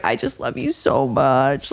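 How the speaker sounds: slow, low, drawn-out imitation of adoration; mock gushing. Contestant's parting words to the host, mock gushing. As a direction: gush it slowly in a put-on voice. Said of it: "just going on and on".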